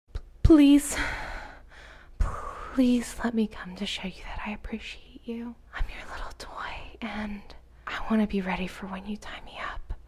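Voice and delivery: stammering breathy whisper; beg, voice breaking to whisper; desperate pleading. Acted.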